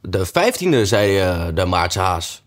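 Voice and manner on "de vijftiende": voiced March Hare. A narrator's reading. March Hare corrects Hatter.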